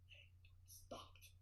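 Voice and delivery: breathy whisper of relief. Retold or imitated. Jet halts at the hangar door, whispered. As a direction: hush it out in awe. Plane stops, hushed relief.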